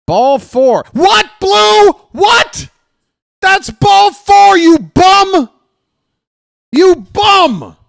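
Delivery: enraged fan bellowing at the umpire; emotional outburst. Real, not acted. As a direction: scream at the blind ump like he robbed you. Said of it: "I got a little emotional".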